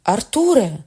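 high, loud cry of the name; indignant exclamation. A narrator's reading. Shipowner rises and exclaims at Arthur, loud and high. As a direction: call out sharply with dignity. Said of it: "вигукнув судновласник".

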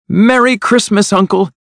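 high, joyful festive greeting; happy cry. A narrator's reading. Nephew arriving to greet his uncle, happy and high. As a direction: call it out cheerily, well up in pitch. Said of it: "cried a happy voice".

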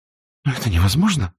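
mildly upset, quickening exclamation; distressed cry. A narrator's reading. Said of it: "в полном расстройстве вскричала".

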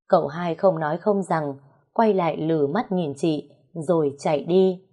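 usual speaking voice

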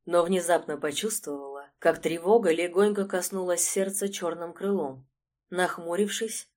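ordinary delivery, nothing marked

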